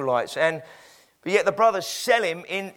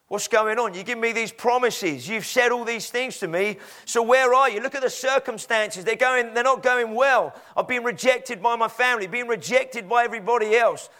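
higher-pitched, mounting protest; indignant complaint; 5 semitones higher than usual. Acted. Betrayed man lists his troubles to God, aggrieved. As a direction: pile up the grievances, pleading for answers.

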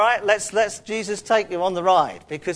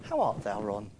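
put-on quiet old English greeting; 9 semitones lower, 7 dB quieter than usual. Shown as a demonstration. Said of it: "God is always referred to as thee and thou".

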